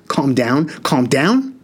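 rising, incredulous anger. Acted. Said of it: "Spoonie's voice got significantly less calm".

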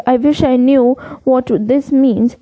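ordinary delivery

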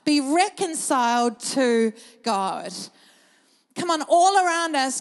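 slow, loud pleading from the pulpit; impassioned preaching. Acted. Pastor pleading with the church to be reconciled, loud impassioned preaching. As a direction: beg them, each phrase leaned on.

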